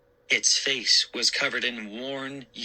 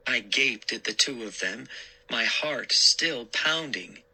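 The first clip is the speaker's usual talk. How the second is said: ordinary delivery, nothing marked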